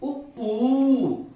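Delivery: ऊ held long and loud; short and long u. Shown as a demonstration. Teacher contrasting उ and long ऊ for pupils. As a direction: stretch out ऊ. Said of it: "उसी तरह से".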